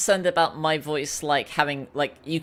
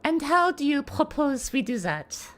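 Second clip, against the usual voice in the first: higher put-on French-accented voice; Celeste; 5 semitones higher than usual. Voice actor challenging a proposal, higher French-accented voice. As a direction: challenge them in a raised French-accented character voice. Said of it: "doing, like, a higher voice".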